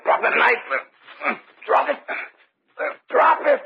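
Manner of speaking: urgent yelled demand, rising to a shout; shouted command. Acted. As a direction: bark it while fighting for the knife. Wrestling knife from Chris.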